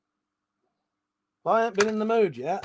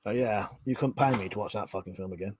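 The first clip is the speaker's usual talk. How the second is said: usual speaking voice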